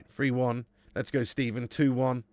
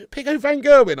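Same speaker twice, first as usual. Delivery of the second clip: loud announcer call; 10 semitones higher, 10 dB louder than usual. Shown as a demonstration. Streamer booming the player's name to chat. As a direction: shout the name big and high. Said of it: "I don't know".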